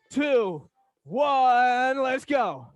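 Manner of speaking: loud count to go; countdown shout. Real, not acted. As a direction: call the start loudly. Countdown calling out.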